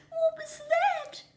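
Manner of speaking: very high, loud, slow terrified cry; frightened cry. A narrator's reading. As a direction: squeal it out in fright. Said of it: "the little Kiwi cried".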